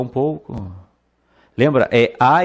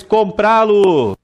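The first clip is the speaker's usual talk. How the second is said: comprá-lo drawn out and higher; slow, high emphasis; 7 semitones higher, 4 dB louder, slower than usual. Shown as a demonstration. Teacher showing the class where the stress falls, slow and high. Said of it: "Vou acentuar".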